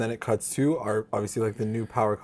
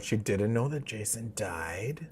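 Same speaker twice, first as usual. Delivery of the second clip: slow, stunned whisper; soft, drawn-out whisper with a rising end; hushed revelation; 7 dB quieter, slower than usual. Real, not acted. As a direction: whisper it like a bombshell. Recounting a shocking reveal.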